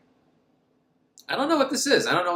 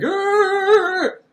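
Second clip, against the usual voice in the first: higher, louder mock grunt; mock fight growl; 7 dB louder than usual. Boy fighting the alien, mock growl. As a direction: growl while wrestling. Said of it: "he's like".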